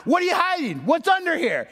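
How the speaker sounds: high-pitched mock shouting; retold yelling. Cop searching the car, yelling at two teens. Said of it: "he starts yelling".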